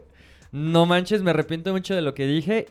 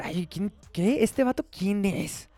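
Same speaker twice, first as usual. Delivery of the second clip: slow, higher-pitched mock who's-that; proud ignorance; 3 semitones higher, 4 dB quieter, slower than usual. Mocking people proud of not knowing an artist, feigned ignorance. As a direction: act proudly clueless, drawn out. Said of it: "lo proclaman como orgulloso".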